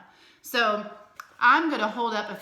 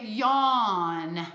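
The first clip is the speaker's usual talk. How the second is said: slow and drawn out; 4 dB louder than usual. Shown as a demonstration. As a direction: stretch "yawn" slowly, long aw. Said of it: "You would say".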